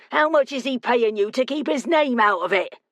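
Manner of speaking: high, goading West Country challenge; accusing West Country local; West Country accent. Acted. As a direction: taunt him in broad West Country. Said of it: "his accent pure West Country".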